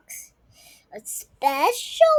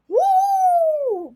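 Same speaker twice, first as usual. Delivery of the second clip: high, loud whoop; cheer; 8 dB louder than usual. Acted. Girls cheering for the finale, high and loud.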